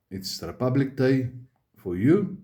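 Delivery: ordinary talk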